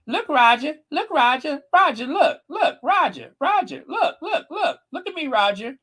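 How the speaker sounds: high, insistent pleading to Roger; hammed-up nagging wife voice; mock pleading. Imitating Kate pleading with Roger.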